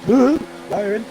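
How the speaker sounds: excited cheer. Real, not acted. Cheering a driver's move.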